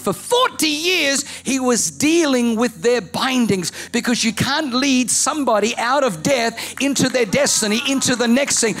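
shouting pastor in full flight; preaching at full force. Acted. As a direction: preach to the climax. Preaching climax.

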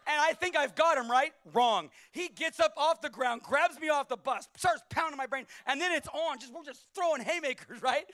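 loud, driving sermon storytelling; loud fired-up preacher; preaching at full force. Acted. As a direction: hammer the story out to the crowd.

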